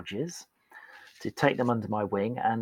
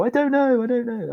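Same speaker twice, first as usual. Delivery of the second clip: much higher, louder panicky squeal; panicked repeat; 13 semitones higher, 6 dB louder than usual. Retold or imitated. Colleague asked how, panicked high squeal. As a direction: blurt it in panic. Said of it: "they'd go".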